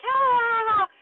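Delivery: high, loud anticipating squeal; excited squeals. Retold or imitated. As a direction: squeal as the category is read. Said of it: "we're like".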